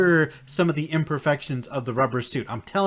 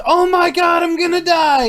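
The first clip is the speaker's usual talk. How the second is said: much higher, louder mock scream of panic; mock panic shout; 11 semitones higher, 6 dB louder than usual. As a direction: ham up a terrified yell. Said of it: "Your complaint isn't".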